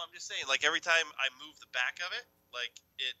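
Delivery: normal talking voice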